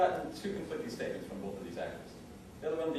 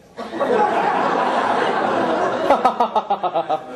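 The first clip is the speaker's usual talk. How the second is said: loud breathless laugh after the punchline; real laughter; 6 semitones higher, 15 dB louder than usual. Real, not acted. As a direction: crack up loudly.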